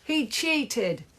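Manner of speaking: high-pitched outrage; screamed accusation. A narrator's reading. Mum accusing Henry, high scream. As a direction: cry it out high. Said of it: "screamed Margaret's mum".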